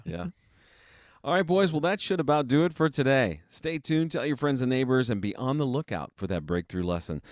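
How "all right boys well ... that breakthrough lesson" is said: higher, louder host sign-off voice; radio host sign-off. Acted.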